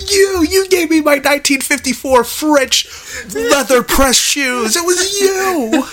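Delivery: high, slow, hammed-up tearful gratitude; overacted weepy thanks; tearful mock son.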